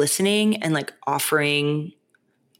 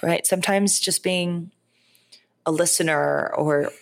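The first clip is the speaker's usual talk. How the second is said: usual speaking voice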